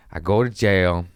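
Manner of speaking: ordinary delivery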